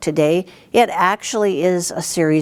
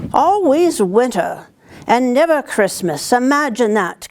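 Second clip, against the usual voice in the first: faun voice; 6 semitones higher than usual. Acted. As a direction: sigh it out like a lonely faun. Faun speaks to Lucy.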